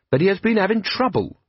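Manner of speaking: put-on eager young captain, respectful; lightly voiced earnest young man; Carrot, earnest young officer. Carrot speaking.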